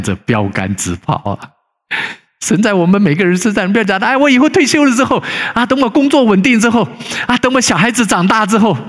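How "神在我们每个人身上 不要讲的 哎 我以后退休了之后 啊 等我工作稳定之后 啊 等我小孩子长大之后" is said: lightly mocking the put-off excuses, pitched up. Pastor mocks churchgoers delaying service, in a raised lightly mocking voice.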